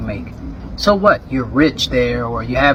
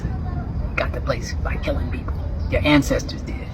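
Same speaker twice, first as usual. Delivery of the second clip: under the breath; 8 dB quieter than usual. Real, not acted. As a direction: drop to a hush for the hard truth. Blunt truth, said under breath.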